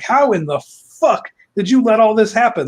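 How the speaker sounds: high, incredulous scolding; hammed-up outrage, leaning hard on the swear; exasperated outburst. Cockhammer berates the others for letting it happen, exasperated.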